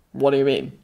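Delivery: low, puzzled man's question; confused. A narrator's reading.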